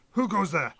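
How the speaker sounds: gruff guard shouting a challenge. Acted. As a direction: bark it out like a wary dwarf guard. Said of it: "you hear a gruff voice shout out".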